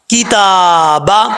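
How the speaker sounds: کتابا with the taa held one alif long. Shown as a demonstration. Teacher demonstrating correct length to students. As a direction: stretch the taa in کتابا, keep the baa short. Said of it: "stand زبر ہے ... لمبا کیا جائے گا".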